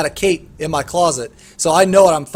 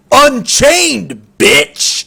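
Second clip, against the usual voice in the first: loud, higher sneering taunt with a spat-out bitch; mock-cocky gamer trash talk; 5 semitones higher, 6 dB louder, much slower than usual. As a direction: throw it at him like a smug insult. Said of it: "He was so cocky when he said it".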